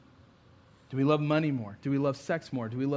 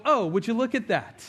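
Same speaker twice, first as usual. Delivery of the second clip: high, hammed-up casual remark; put-on "well, look at that" composure; mock-polite surprise; 6 semitones higher than usual. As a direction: feign calm interest, raised pitch. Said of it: "It's not what I did at all".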